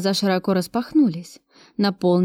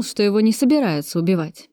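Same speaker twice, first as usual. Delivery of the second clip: ordinary delivery, nothing marked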